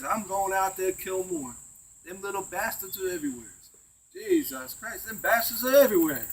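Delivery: rising into a loud yell by the end; retold screaming. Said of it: "he's screaming this".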